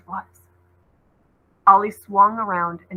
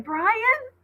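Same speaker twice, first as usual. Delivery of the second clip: calling. Acted. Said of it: "Ollie called again".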